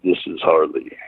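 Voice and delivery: low veteran answering the phone; Harley Race impression. Harley answers the prank call, Harley Race impression. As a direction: answer curtly as the old wrestling boss. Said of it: "And he goes".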